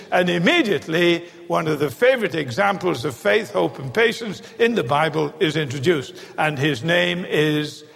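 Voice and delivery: normal talking voice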